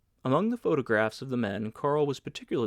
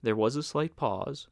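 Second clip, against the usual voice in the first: usual speaking voice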